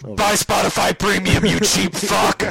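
loud, slow shouted ad read. Retold or imitated. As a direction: bellow it like a pitchman. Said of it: "that was a Gus Johnson thing".

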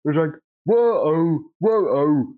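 put-on rambling old-timer storyteller; old-timey old man voice. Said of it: "an old-timey old man voice".

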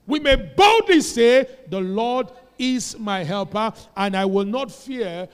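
loud, slow proclamation; bold declaration. Shown as a demonstration. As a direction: declare it with full authority. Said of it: "When you speak boldly".

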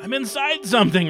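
high, strained, smothered voice; smothering. Acted. Rachel swallowed by the monster, high smothered gasp. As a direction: gasp it out from inside the mouth.

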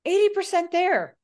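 excited. Real, not acted. Excited comment on dub.

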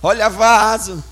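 slow, solemn mock prophet voice; prophesying sister. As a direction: drag the words like a self-important prophetess. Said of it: "Aí vem minha irmã". (imitação lenta de irmã profetizando)

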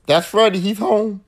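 normal talking voice

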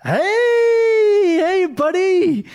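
very high, loud, drawn-out hey buddy; fake-friendly cry. Doctor greets a colleague whose name he forgot, overly cheery. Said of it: "I'm like".

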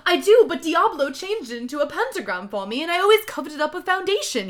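high, loud, whiny reply; hammed-up character voice; pained retort. A narrator's reading. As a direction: explain it in a melodramatic rush, speeding up. Acted answer shouted back in story.

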